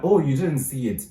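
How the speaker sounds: retold surprise. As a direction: react as if the friend missed big news. Retold surprise at friend's news.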